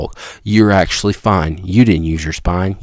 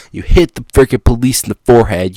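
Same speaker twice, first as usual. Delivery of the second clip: usual speaking voice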